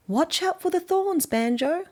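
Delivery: lady beetle voice. Acted. Lady beetle warning Banjo about thorns, high and bright. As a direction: chirp a little warning.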